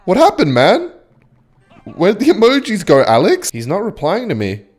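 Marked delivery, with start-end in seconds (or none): 0.0-3.5 high, sing-out needling; mocking taunt. Real, not acted. Winner asks opponent where the emojis went, mocking taunt. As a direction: needle a beaten rival in a raised pitch.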